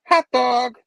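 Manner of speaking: high, loud fangirl exclamation; loud delighted cheer; happy fan. Acted. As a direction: punch it out with glee. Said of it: "So she's all happy, like a fan".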